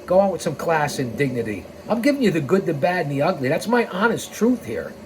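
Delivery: ordinary delivery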